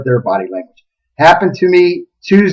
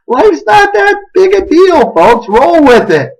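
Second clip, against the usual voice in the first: high-pitched, drawn-out exhortation; exclaimed advice; 11 semitones higher, slower than usual. Real, not acted. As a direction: plead comically with everyone to lighten up. Exclaimed advice after the story.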